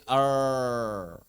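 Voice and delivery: "er" held as an English R, no vibration; untrilled R. Shown as a demonstration. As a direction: drop the trill from "er". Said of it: "menghilangkan getarannya". ("er" tanpa getaran)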